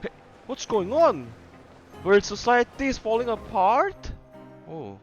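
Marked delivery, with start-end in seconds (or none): none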